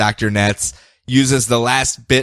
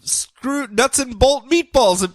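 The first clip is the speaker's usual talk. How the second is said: high exasperated; 11 semitones higher than usual. Real, not acted.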